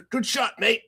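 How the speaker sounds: high-pitched mock English accent; retold English player, put-on accent. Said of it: "deep English accent".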